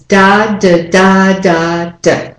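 "da, da, da, da, da" as long, short, long, long, short; stress rhythm. Shown as a demonstration. Teacher giving the word's rhythm, strong and weak beats. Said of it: "The rhythm is".

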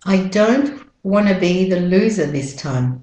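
British-Australian "loser" without final R; British-Australian model sentence; British-Australian accent. Shown as a demonstration. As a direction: run "wanna be" together, British-Australian style. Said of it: "And with the American accent".

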